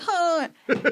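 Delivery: high, laughing guard; Italian guard voice. Retold or imitated. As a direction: squeak out a stern command. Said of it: "he's like".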